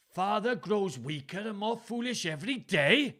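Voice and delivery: high, loud Welsh reading aloud; Welsh accent. A narrator's reading. Father reads incriminating letter aloud, Welsh accent. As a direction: read it out in swooping stage Welsh. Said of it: "Gloucester read aloud".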